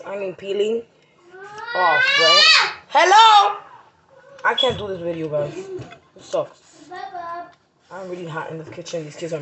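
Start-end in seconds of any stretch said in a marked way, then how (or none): 1.7-3.6 sharp, much higher and louder call. Real, not acted. Yelling over noisy kids.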